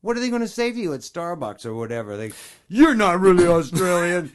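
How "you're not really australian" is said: raised, higher mock accusation; loud mock challenge. Imagined coffee shop customer challenging a fake Australian, loud mock accusation. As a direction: call it out as a hammed-up objection.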